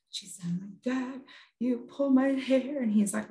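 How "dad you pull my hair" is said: put-on slow, higher small child voice; lightly voiced hurt daughter; little girl. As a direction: imitate a child haltingly telling on Dad. Daughter timidly telling dad, retold.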